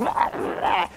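rasped, voiceless mock screech; mock possessed cries. Possessed people reacting to the monstrance, mock screeching. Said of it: "all of a sudden people are like".

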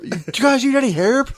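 high-pitched mock rooftop holler; imitated scream. Landlord offering help from the roof, mock screaming. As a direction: call it down from far away. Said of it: "He just screamed it".

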